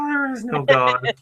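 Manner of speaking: high, slow mock despair. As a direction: wail it like the world ended. Mock despair after gasp.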